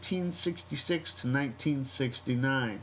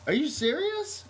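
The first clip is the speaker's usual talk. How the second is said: disbelieving question; 12 semitones higher than usual. Retold or imitated. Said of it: "I'm like".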